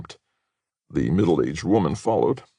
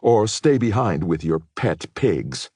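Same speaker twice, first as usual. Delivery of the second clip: slow, scornful taunt; the woman mocking Katie's friends as pigs; contemptuous sneer; 5 semitones higher, 4 dB louder than usual. A narrator's reading. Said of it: "the woman told her".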